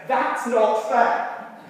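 put-on a kid crying foul, stretched and loud; indignant child. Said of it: "a look that says".

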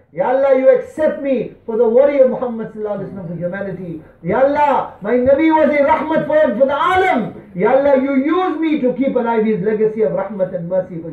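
impassioned dua before the congregation; fervent public supplication. Acted. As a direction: implore Allah with rising fervour. Preaches, pleading with Allah aloud.

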